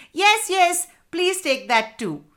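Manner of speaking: high-pitched eager plea; eager children. A narrator's reading. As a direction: pipe up eagerly as excited kids. Children answer father eagerly together.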